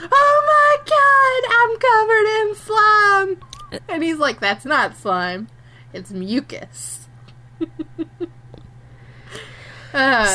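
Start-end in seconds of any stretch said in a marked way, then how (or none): 0.1-3.8 very high, loud mock scream; hammed-up horror, stretched out and shrieked; panicked shriek. Acted panic on turning into frog. 4.4-7.1 slow, measured mock pedantry; deadpan correction. Acted deadpan correction after shriek.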